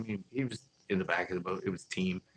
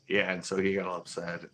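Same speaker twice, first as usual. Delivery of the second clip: ordinary talk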